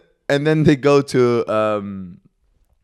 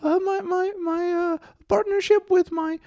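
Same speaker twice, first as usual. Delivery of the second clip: very high, strained voice, halting with hesitations; the patient talks earnestly about his love life in a squeaked voice; damaged voice. Retold or imitated. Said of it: "talks like this".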